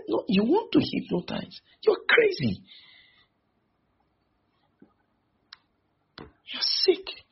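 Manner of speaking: imitating his own furious shout at the man; retold furious retort. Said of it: "I got very furious".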